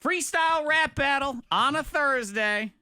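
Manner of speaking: upbeat host announcement; show announcer. Acted. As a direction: announce the segment with energy. Announcing the segment.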